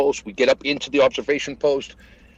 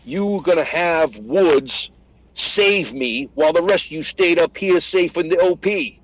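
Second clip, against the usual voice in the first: raised-pitch mock berating; retold hard time; 4 semitones higher than usual. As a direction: chew out the squad, half in earnest. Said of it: "I start giving everyone a hard time".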